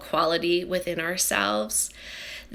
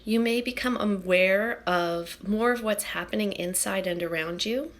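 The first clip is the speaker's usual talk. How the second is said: hushed, unhurried breath-by-breath guiding; guided meditation; 6 dB quieter than usual. Acted. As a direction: guide the breath gently, phrase by phrase, with long pauses.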